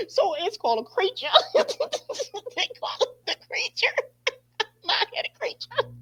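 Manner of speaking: high, helpless laughing voice. Real, not acted. Host tickled by the creature nickname, laughing hard. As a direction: squeeze the words out through real laughter.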